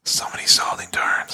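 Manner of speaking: hushed stage whisper. Pretend. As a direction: whisper it like a secret. Hushed joke after loud hawking.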